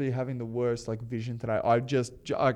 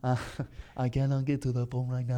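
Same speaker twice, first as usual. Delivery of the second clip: put-on automated-sounding phone voice; voicemail reenactment. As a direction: mimic a friend's odd accented recording. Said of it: "that's a pretty good reenactment".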